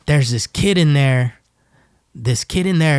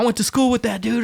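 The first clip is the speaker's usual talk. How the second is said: much higher, excited brag; hyped inmate bragging; 9 semitones higher than usual. Retold or imitated. Inmates watching football, much higher excited bragging. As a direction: brag like a fan pointing at the TV.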